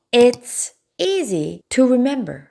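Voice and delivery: slow, word by word; slow model sentence. Shown as a demonstration. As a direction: read it slowly for the class. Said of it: "기억하기에 쉬워". (천천히 또박또박 예문 읽기)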